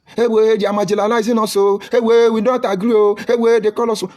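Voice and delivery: high, loud whining protest; mocking cry of complaint. Speaker mocking those who cry when pinched, loud whiny wail. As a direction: wail like a group crying foul. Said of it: "we begin to cry".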